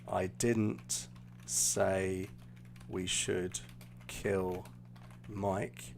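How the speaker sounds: word by word, long pauses between chunks; slow, spaced-out reading. Shown as a demonstration. Teacher writing the example phrase for students, slow and broken up. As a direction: dole the phrase out slowly and softly. Phrase written out while spoken.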